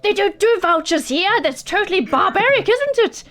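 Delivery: high, indignant shopkeeper rant; high-pitched merchant fed up; high exasperated merchant. Acted. Merchant rants.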